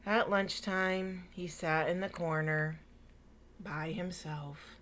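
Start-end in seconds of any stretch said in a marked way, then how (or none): none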